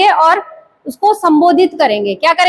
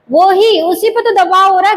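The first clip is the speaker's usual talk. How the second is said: ordinary delivery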